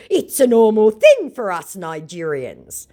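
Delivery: loud, slow matter-of-fact boast; a lightly voiced smug scammer; hint of scammer bravado; mock scammer voice. Voicing scammer's message.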